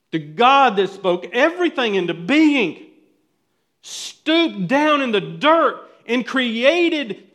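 rising preaching. Acted. Pastor marvelling at God forming man, rising preaching. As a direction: swell with wonder at creation.

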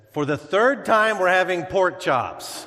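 acted loud, high, fed-up retort; exasperated wife. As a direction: raise your voice at a husband who won't hear. Fed-up wife answering deaf husband.

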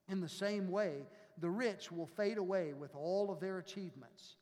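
calm scripture reading from the pulpit. Acted. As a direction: read the passage like a lector. Reads scripture aloud.

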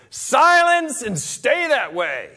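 loud, high, slow command to the storm; Jesus shouting down the storm, a furious yell; yelled rebuke. Acted. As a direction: bellow it over the wind and waves. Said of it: "better to picture a scene".

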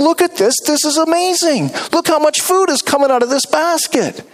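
high, excited mock wonder; mock amazement. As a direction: gush like the disciples should have. Mocks what disciples should say.

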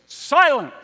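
sudden high, loud command; commanding shout. Acted. King or general silencing a room in pandemonium, commanding shout. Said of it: "the king or the general, and says".

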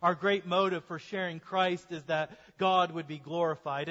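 usual speaking voice

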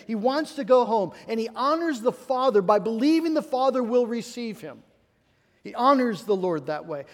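mildly fervent sermon delivery; rising preaching. Acted. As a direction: build toward the point for the congregation. Pastor builds sermon again.

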